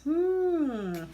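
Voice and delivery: high playful hmm; teasing hum. Real, not acted. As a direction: tease them with a knowing hum. Teasing surprise guest.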